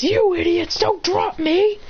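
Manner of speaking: mock angry yell. Bakugo yelling at laughing carriers not to drop him, angry. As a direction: scold them for nearly dropping you.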